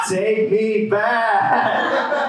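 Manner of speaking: high, long-drawn holler; stretched, high-pitched mock plea; mock nostalgic cry. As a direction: cry out slowly in hammed-up longing. Mock nostalgia for the good days.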